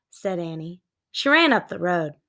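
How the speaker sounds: ordinary delivery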